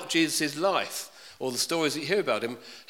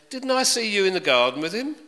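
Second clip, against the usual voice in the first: accusing; 7 semitones higher, 6 dB louder than usual. Acted. Servant accuses Peter.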